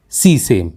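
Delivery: सी सेम said as "see-saym"; common mispronunciation. Shown as a demonstration. Teacher shows learners the common mistake, mispronounced. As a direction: say सी सेम as two long syllables, silent final e. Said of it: "गलत उच्चारण करते हैं".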